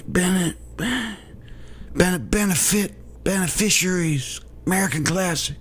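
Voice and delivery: mock Biden losing his words; mock Biden rambling. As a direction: stumble through words like a confused old man. Biden impression continues.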